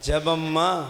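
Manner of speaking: normal talking voice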